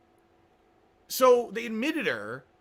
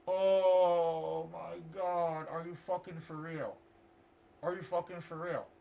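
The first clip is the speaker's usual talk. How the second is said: very slow, soft, drawn-out disbelief; stunned disbelief; 4 dB quieter, slower than usual. Retold or imitated. Reacting to the counselor's useless advice, slow stunned disbelief. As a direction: drag it out, deadpan and stunned.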